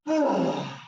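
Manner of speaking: big sigh. Shown as a demonstration. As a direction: let it all out in one big sigh. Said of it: "our exhale is gonna be a big sigh".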